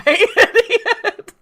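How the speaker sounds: high, slow assistant voice with laughter; high, drawn-out mock assistant; Siri-like voice. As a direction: draw it out like a voice assistant, amused. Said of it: "like the Siri of".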